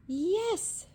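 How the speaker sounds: high, bright "Yes"; eager cry. A narrator's reading. Witch gladly welcoming the bird aboard. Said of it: "cried the witch".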